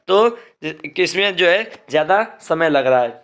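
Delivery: ordinary talk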